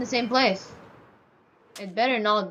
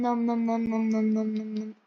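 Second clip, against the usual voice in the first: playful nom noises; 5 dB quieter than usual. Pretend. Mock eating sounds.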